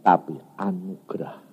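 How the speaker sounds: low, whispered conclusion; hushed emphasis. Real, not acted. Preacher tells congregation all is grace, hushed whisper. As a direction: drop to a reverent whisper. Sermon contrast after emphatic list.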